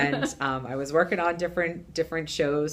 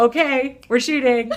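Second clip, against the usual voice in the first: high, loud call to shoot; loud crew call, on set; calling action; 10 semitones higher, 10 dB louder than usual. Retold or imitated. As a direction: announce it to the whole set.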